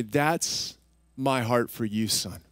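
low, hushed, paternal reassurance; tender fatherly voice. Retold or imitated. God revealing his heart to his son, low and tender.

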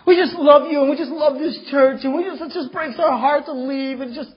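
hammed-up church member gushing goodbye; mock gushing farewell. Departing members gushing at preacher.

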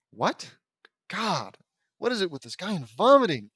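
loud, high exasperated complaint. Real, not acted. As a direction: groan it in disbelief. Reader's exasperated aside.

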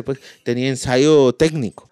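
usual speaking voice

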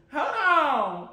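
ordinary delivery

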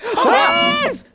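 high, loud excited yell; excited shout. Real, not acted. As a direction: burst out the discovery. The boy is suddenly found.

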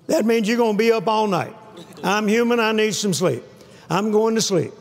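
ordinary talk

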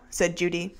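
ordinary delivery, nothing marked

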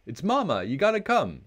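high, loud call rising in alarm; urgent shout. A narrator's reading. Brother running to Papa in the woods, a high urgent shout. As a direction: shout for help, panicked. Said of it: "shouted Brother".

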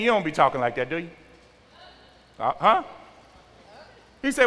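ordinary talk, nothing marked

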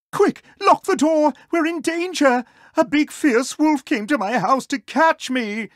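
very high, loud frightened pig voice; high-pitched cartoon alarm; panicked warning. Terrified pig warns his neighbour.

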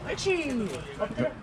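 bright high-pitched praise for the dog. Real, not acted. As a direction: lift the voice high to reward.

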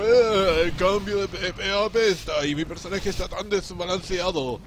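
exaggerated loud gamer whine; mock an outraged player, loudly; loud whiny complaint. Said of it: "otra gente como".

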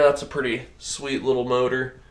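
normal talking voice

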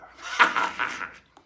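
short staccato pirate laugh; extra laugh. Shown as a demonstration. Pirate gives the editor a spare laugh, short guffaw. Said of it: "Extra laugh just for the editor".

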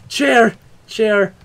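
loud, high startled exclamation; high, loud yelp of protest; startled cry. Real, not acted. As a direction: call out as if it just made you jump. Startled by a chair in game.

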